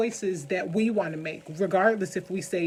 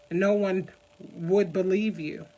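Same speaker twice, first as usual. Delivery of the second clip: usual speaking voice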